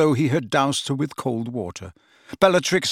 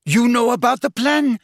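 outraged; 11 semitones higher, 6 dB louder than usual. A narrator's reading. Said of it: "look of outrage".

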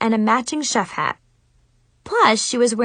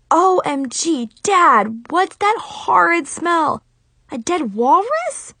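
higher, slow shocked shriek; 8 semitones higher, 3 dB louder, slower than usual. A narrator's reading. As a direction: shriek in disgust. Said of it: "I shrieked".

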